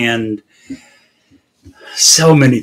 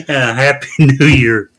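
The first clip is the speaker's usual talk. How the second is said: trying not to laugh. Real, not acted. Host reading the clinic's botched Christmas correction, laughing despite himself. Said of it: "I'm laughing and I shouldn't".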